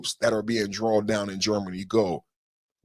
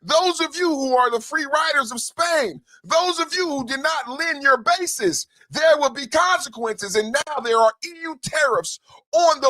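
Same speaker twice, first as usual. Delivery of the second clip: rally preaching. Real, not acted. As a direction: declare it like a stadium anthem. Rally-style chanted proclamation.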